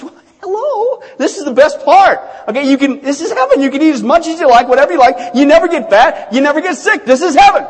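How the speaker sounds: loud, high, emphatic explaining; fed-up exasperation. Acted. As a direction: spell it out, at the end of your patience. Exasperated Peter explains heaven once more.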